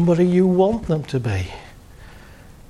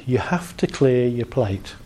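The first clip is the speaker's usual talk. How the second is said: inner parental voice; 6 semitones lower than usual. Retold or imitated. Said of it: "the voice comes into my head".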